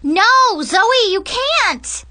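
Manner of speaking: high, loud, drawn-out protest; panicked near-scream. Acted. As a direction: stop them in a panic, almost screaming. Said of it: "I almost screamed at her".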